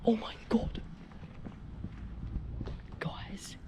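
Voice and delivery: nervous night vlog whisper. Real, not acted. Exploring abandoned place at night.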